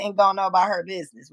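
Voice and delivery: ordinary delivery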